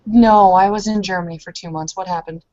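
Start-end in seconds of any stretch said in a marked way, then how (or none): none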